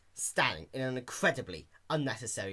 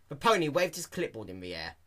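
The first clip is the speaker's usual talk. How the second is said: usual speaking voice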